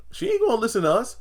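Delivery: high, fast imitation of Sharon; squeaky quick brush-off; hammed-up high Sharon voice; mock Sharon. Voicing Sharon in recap.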